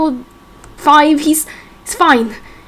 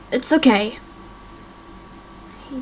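ordinary delivery

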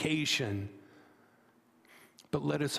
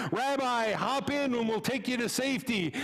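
higher, friendly call from the truck; eager neighbor; 6 semitones higher than usual. Acted. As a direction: shout the offer over the water. Neighbor in truck offers ride.

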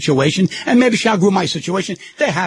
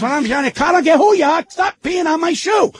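retold yell; 9 semitones higher than usual. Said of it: "what are you yelling".

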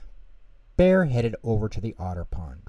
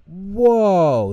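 amazed whoa; 10 semitones higher, 9 dB louder than usual. Acted. Pip and Pop amazed.

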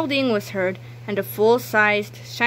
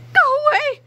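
high, loud order; commanding shout; 6 dB louder than usual. Acted. Miss Ribble ordering the toilets away, high loud shout. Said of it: "she cried".

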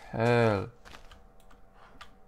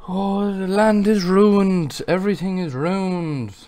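slow, much louder hammed-up despair; mock tragic lament; 7 semitones higher, 9 dB louder, slower than usual. Gamer mourning the ruined land, hammed-up lament. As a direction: declaim it like a tragic hero.

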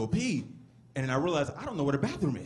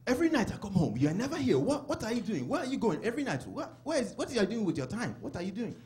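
put-on higher, questioning accented father; Nigerian dad; 4 semitones higher than usual. Dad grilling his son about nights out, imitated exasperation. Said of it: "like my dad".

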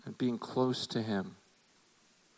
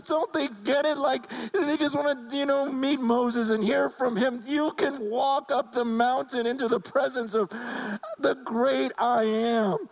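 high, impassioned imitation of a crying pastor; retold tearful plea; 9 semitones higher, 3 dB louder than usual. Retelling an Indian pastor's phone call, imitated tearful pleading. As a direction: plead urgently, pitched way up, stretching the key words. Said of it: "and he's crying".

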